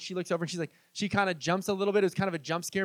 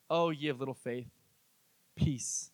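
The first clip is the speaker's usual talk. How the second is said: calming; 5 semitones lower, 5 dB quieter than usual. Retold or imitated. Jesus calming the storm.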